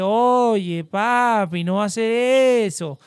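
drawn-out mock Colombian provincial accent. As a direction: stretch the words in a Colombian sing-song. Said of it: "van a hablarle así de".

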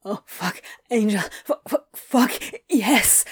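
breathless broken gasps; gasping. A narrator's reading. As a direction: stutter it out between gasps. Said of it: "he gasped".

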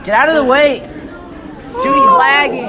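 loud laughing yells; hyped gamer bro. Real, not acted. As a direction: yell it high with laughter. Hyped yelling in game.

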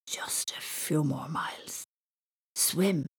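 whispered, slow sleep-talk; sleepy mutter. A narrator's reading. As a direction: mumble it half asleep. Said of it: "muttered Thomas in his sleep".

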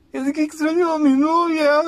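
put-on a drunk lovesick sob; drunk crying. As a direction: wail it out through tears. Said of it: "Y empezaba a llorar".

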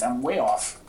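usual speaking voice

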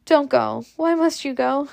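high, pleading question; desperate plea. A narrator's reading. Scarlet begs the old man not to go, desperate plea. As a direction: beg someone to stay. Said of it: "she cried desperately".

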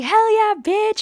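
high, loud hype cheer. Retold or imitated. Friend cheering at the sex club, high and loud. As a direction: hype her up.

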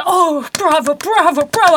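imitating an adoring audience; mock bravo cheers. Imitating the theatre crowd cheering bravo. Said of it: "everybody was like".

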